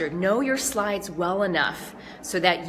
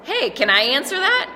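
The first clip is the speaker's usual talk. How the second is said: joking lightly; 6 semitones higher, 4 dB louder than usual. Pretend. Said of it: "maybe you joke around about it and take it lightly".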